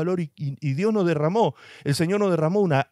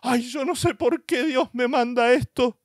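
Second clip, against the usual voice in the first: higher, whining mock lament; whiny complainer; 7 semitones higher than usual. Preacher mimicking complaining churchgoers, whiny. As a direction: mimic a believer moaning at God. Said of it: "hay quienes dicen".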